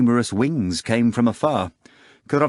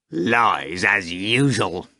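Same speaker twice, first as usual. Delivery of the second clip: growled; much slower than usual. A narrator's reading. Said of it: "Azazello growled".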